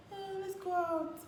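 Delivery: sing-song high invite, slowly; high playful invite. Retold or imitated. Girlfriend coaxing boyfriend out, high and slow. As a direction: coax him out lightly, up high.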